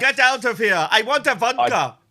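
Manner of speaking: loud, high hammed-up Russian; mock Russian accent. Host showing off after praise for his Russian, loud mock Russian accent. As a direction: bellow in a cartoonish Russian accent. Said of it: "I think your Russian's quite good".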